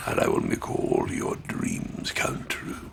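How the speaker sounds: slow, breathy whisper of a tempter; a seductive slow whisper; whispered temptation. Acted. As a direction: lure him in a hushed slow whisper.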